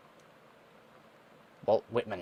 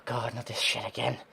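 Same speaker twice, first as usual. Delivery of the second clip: ordinary talk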